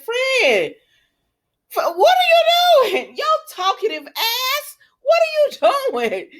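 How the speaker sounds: high, loud exasperated yell breaking into laughter; laughing yell at character. Retold or imitated. Viewer yelling at talkative Finn for spilling the tea, laughing exasperated shout. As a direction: scold the blabbing character, laughing.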